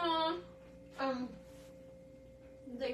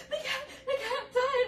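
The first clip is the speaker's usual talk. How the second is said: mock sobbing mom; 12 semitones higher, slower than usual. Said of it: "and she's like".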